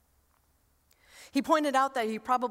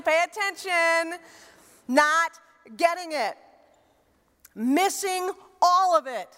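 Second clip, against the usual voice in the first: louder, higher, slow and deliberate; fervent slow preaching; preaching emphasis; 6 semitones higher, 4 dB louder than usual. Acted. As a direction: drive the point home.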